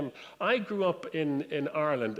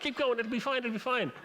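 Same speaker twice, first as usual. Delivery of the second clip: higher, breezy brush-off; dismissive reassurance; 8 semitones higher than usual. Retold or imitated. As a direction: passenger waves the crew on to Dublin, breezy. Passenger reassuring crew.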